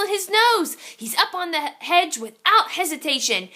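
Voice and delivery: usual speaking voice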